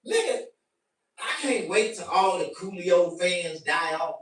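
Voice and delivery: usual speaking voice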